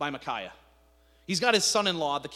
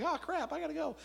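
higher panicked cry; panicked yelp; 10 semitones higher, 5 dB quieter than usual. Retold or imitated. Jehoshaphat, shot at, panics and runs away. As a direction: yelp and flee.

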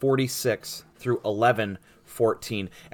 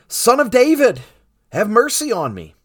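loud, high plea that falls away; crying out; 10 semitones higher, 7 dB louder than usual. A narrator's reading. Blind beggar calls to Jesus passing by, crying out. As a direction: call out for mercy over a crowd. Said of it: "he began to cry out".

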